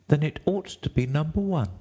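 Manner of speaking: put-on high-pitched defiant girl; Alice. A narrator's reading. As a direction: refuse in a high girl's voice. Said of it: "said Alice".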